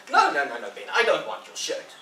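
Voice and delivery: put-on high, loud dismissive boy; refusing Tom. Tom refusing Ben's shirt, loud and dismissive. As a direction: wave it off loudly.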